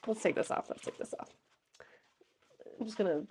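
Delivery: fading into a whisper; whispered aside to herself. Real, not acted. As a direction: drop to a whisper on the repeat. Repeats phrase fading to whisper.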